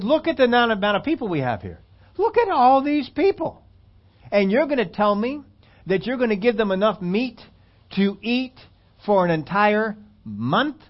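high, disbelieving Moses; can't believe his ears; incredulous Moses. Acted. Moses questioning God about the meat, incredulous. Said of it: "Moses cannot believe what God has said".

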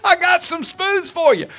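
high, loud repeat of God's promise; preaching shout. Acted. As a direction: belt it out to the congregation. Repeating God's line with fervor.

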